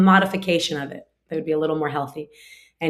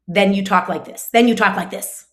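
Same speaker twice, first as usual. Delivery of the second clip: louder, raised, cutting tone; acted agitation, building louder on the repeat; sharp, agitated; 3 semitones higher, 6 dB louder than usual. Shown as a demonstration. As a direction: demonstrate an irritated parent's snappy voice. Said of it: "gets sharp and agitated".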